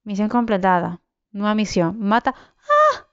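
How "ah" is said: sudden high shriek; startled yelp when attacked; scream of fright. Real, not acted. Raiders attack, sudden cry. (grito agudo de susto)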